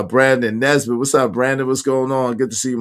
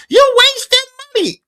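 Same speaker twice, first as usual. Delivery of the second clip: high squeaky mocking voice of critics; mock scolding; 7 dB louder than usual. Imitating people who scold him about spending. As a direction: sneer it like a nagging busybody. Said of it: "people be like".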